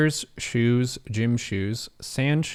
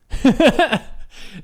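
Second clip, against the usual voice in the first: burst of laughter; 13 semitones higher, 7 dB louder than usual. Real, not acted. Laughs at the broken map.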